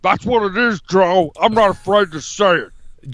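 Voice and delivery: hammed-up tough-guy politician impression; comic strongman bluster; Jesse Ventura. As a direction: declare it like a blustering off-grid candidate. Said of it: "Okay, Jesse".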